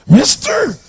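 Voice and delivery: put-on a drunk beggar asking for a quarter in a strange high voice; bizarre voice. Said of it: "He had this really bizarre looking sounding voice".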